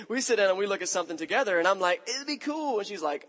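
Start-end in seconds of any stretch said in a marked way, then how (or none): 2.1-2.8 much higher put-on surfer dude voice; mock chilled-out dude; laid-back dude. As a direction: play the carefree surfer telling everyone to relax. His casual answer to wife.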